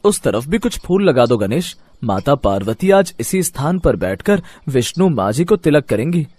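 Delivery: plain reading voice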